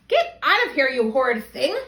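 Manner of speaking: Granny scolds the intruding wolf, loud and angry; furious Granny. Acted. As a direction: drive the wolf out with a furious shout. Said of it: "Granny was furious".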